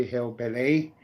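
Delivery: ordinary talk, nothing marked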